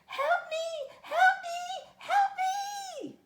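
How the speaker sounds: very high, loud frightened cry; frightened harp. Acted. Harp being carried off, high fearful cry. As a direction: shriek like a terrified magic harp. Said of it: "sang high, fearful notes".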